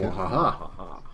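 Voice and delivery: slow drawn-out villain cackle; evil laugh. Pretend. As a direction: cackle slowly like a cartoon villain. Villain laugh over misdirected artillery.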